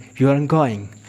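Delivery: "aren't" squeezed into one syllable, very slow; contracted aren't. Shown as a demonstration. Teacher modelling the contraction "aren't" slowly. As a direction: say "You aren't going" slowly with a short "aren't". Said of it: "तो इसे बोलते हैं aren't".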